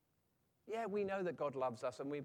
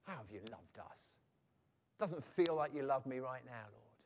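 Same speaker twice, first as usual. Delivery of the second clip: doubting; 5 semitones lower than usual. Acted. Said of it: "we begin to doubt it".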